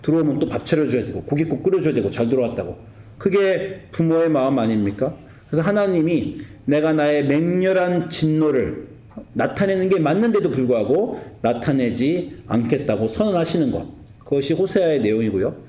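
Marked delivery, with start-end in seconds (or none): none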